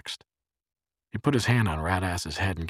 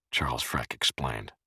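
ordinary delivery, nothing marked

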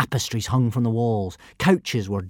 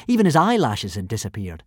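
usual speaking voice

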